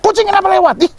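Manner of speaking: high-pitched petty scolding, played for laughs; hammed-up fussing at a cat; mock scolding. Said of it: "Kucing lewat di depan rumahnya aja dimarahin".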